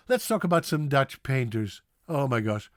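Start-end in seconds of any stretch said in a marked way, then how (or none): none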